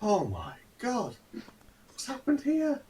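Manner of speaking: high, slow gasp of shock; a passer-by aghast at the blood. Acted. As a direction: onlookers staring at the scene, shocked. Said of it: "they're just like".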